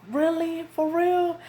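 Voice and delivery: high, thrilled disbelief; surprised mom. Retold or imitated. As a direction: pitch it way up in delighted shock. Mom hears the pregnancy news.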